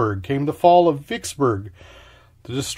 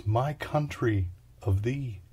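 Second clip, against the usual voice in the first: soft murmur; 3 semitones lower, 8 dB quieter, slower than usual. Acted. Murmured anthem fragment.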